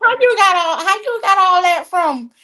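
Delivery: high-pitched retold disbelief; incredulous question. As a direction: ask it way up high, acting out astonishment. Acting out own incredulous question.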